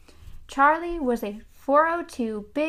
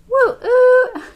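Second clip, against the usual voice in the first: a high, loud hooting whistle blast; a joyful, high, loud woo-oo; train whistle; 13 semitones higher, 13 dB louder than usual. Acted. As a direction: blow the happiest steam whistle. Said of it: "he blew the whistle himself".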